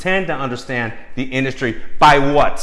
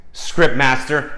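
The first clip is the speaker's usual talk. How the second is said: ordinary talk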